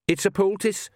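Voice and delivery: sudden high rush; blurted out. A narrator's reading. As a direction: blurt it out nervously. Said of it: "she blurted out".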